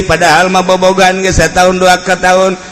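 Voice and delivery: ordinary delivery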